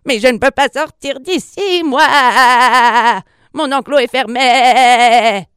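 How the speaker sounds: high wail ending in long quavering bleats; distressed lamb voice; bleating lamb. A narrator's reading. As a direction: stretch each phrase end into a baa. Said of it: "bêla l'agneau tout ému".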